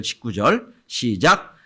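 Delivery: ordinary delivery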